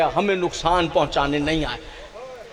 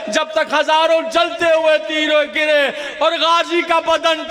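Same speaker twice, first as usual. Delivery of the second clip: high, wailing cry building to full force; impassioned majlis lament; 10 semitones higher, 3 dB louder than usual. Acted. Reciter telling mourners of Abbas's broken body, anguished wail.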